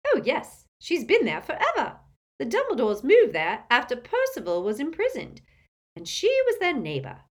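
put-on high, loud old woman; breezy matter-of-fact gossip; gossipy old aunt. Muriel voiced high.

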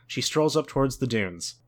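ordinary talk

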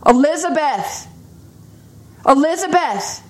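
high calling shout of a name; retold shout. A man across campus calling her name, high shout. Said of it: "someone shouted".

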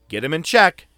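silent warning. Retold or imitated. Telling the buddy to get him in check. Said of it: "eye widen saying".

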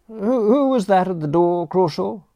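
put-on high, nervous young gent; flustered Bertram. Bertram to the butler after the row, high nervous question. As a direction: stammer out the question anxiously.

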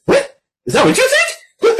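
very high, squeaky disbelief; Serpentor affronted. Retold or imitated. New emperor rejecting the figurehead offer, squeaky mock outrage.

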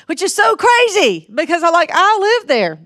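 high, loud burst of disbelief; incredulous exclamation. Real, not acted. Exclaims over her own neighbourhood.

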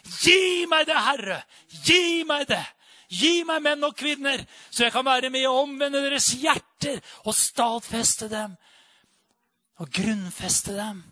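high, loud, urgent plea; loud pleading prayer, easing at the end; crying out to God. Pretend. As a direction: shout your prayer to heaven. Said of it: "du kan skrike og rope og være den røsten".

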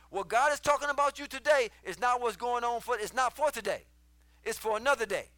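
loud, high sermon delivery; full-force preaching. Acted. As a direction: drive it home to the congregation like a preacher.

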